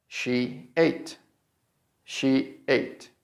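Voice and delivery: "she ate" softly, as two separate syllables; final part sounded out. Shown as a demonstration. Teacher breaks down the final part, soft separated "she ate". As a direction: split "she ate" for the ending of negotiate. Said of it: "is pronounced like".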